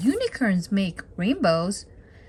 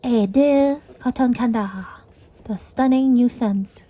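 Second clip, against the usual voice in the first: louder posh advert voice; mock perfume ad; 6 dB louder than usual. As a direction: sell the scent like a fancy ad.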